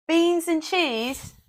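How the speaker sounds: high-pitched, drawn-out British vendor call; bad British accent. Food-cart seller announcing the most common order, bad British accent. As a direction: put on a hammy British accent. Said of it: "that was a bad British accent".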